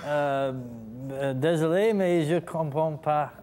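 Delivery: lightly put-on French apology; French reply. Actor answering the host in French, sheepish. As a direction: say it like a sheepish Quebecer. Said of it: "That was actually quite well done".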